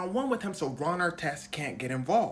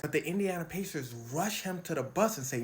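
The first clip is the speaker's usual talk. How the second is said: normal talking voice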